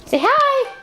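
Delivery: high, loud cooing to the dog. Real, not acted. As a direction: coo it up high. Prompting dog to greet.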